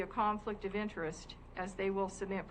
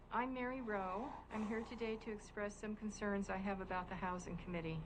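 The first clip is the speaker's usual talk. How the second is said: ordinary talk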